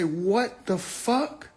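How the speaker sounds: slow, soft amazed exclamation; hushed stunned what. Retold or imitated. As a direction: crowd stunned, mock hushed awe. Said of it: "Everybody say".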